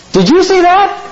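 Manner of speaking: amazed excited question. Acted. Optimistic farmer asks his neighbor, amazed and high. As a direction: shoot the line up high in disbelief.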